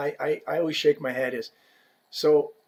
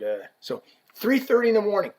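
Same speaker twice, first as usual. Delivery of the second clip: usual speaking voice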